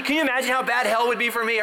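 usual speaking voice

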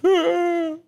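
high-pitched comic wail; mock crying. Recruit crying in front of fellow soldiers, mock wail. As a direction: imitate sobbing in a thin high whine. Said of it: "Cried the whole service".

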